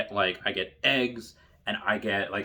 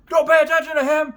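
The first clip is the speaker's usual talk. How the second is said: high, loud bark; drill instructor order; 7 dB louder than usual. Retold or imitated. Drill instructor orders trainees to ignore him, high loud bark.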